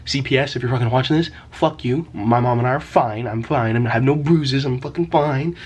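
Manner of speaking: emphatic, rising irritation; defensive insistence. Real, not acted. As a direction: firmly shut down any suspicion, voice lifting as it goes. Defensive outburst at imagined CPS viewers.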